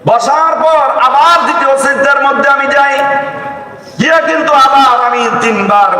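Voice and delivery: high, impassioned preacher's cry; fervent preaching. Acted. Preacher to the congregation about prayer, fervent high preaching. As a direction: lift the voice and preach to the crowd.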